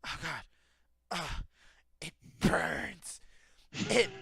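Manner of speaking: halting moans rising in pitch and volume; mock suffering, building. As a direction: moan in imitated agony, building up. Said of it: "she would quiet down".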